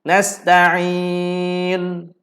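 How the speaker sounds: Nasta'in with the lips pushed forward on the final ain; rounded lips ishmam. Shown as a demonstration. Ustaz shows the class how to pout on nasta'in. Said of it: "macam tu".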